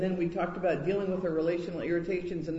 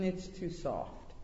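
slow, much softer sigh of a remark; sighing critique; 7 dB quieter, slower than usual. A narrator's reading. Angel inspecting the model, slow soft sighing verdict. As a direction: let it fall away on a sigh. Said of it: "sighed and said".

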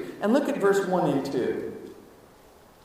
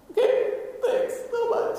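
mock nervous thanks. Retold reply, high squeaky laugh.

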